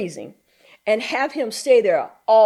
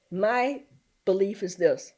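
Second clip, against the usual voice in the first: ordinary talk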